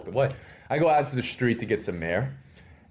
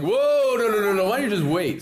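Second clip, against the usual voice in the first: high, loud panicked refusal; 11 semitones higher, 5 dB louder than usual. Retold or imitated. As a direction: stop them urgently. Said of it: "I'm like".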